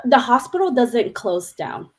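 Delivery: normal talking voice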